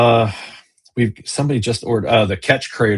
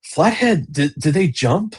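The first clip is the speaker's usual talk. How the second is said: pitched way up in disbelief on Flathead; can't believe a fish jumped, voice leaps higher; retelling his reaction to the buddy, higher and disbelieving; incredulous question; 7 semitones higher, slower than usual. Retold or imitated. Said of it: "And I'm like".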